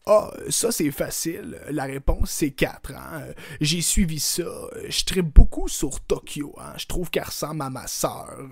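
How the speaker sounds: loud, chummy premier voice; Legault impression. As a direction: play the premier, proud and folksy. Playing Legault answering.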